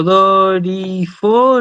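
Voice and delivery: DORIFOROS slowly drawn out, syllable by syllable; "doriforos" said slowly, split into its syllables. Shown as a demonstration. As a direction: stretch each syllable of "doriforos". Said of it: "yo separo en sílabas y digo".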